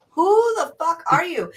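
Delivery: loud startled high retort; shocked yell. Retold or imitated. Woken early, snapping at the mummy at her door, loud and shocked.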